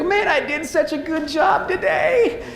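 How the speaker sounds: the proud rookie who doesn't know he nearly died; smug jumper. Retold or imitated. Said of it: "He's listening to the instructor on the radio".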